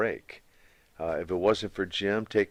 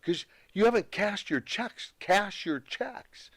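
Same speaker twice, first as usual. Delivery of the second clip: a higher, insistent imitation of Dave the accountant, ending slow; imitating the accountant; 9 semitones higher, 3 dB quieter, slower than usual. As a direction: mimic the nagging bookkeeper, pitched up. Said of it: "He goes".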